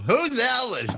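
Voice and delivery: high, puzzled mock holler; hammed-up indignant question; imitated meeting guest. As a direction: mimic a baffled guest calling out. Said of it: "hear people going".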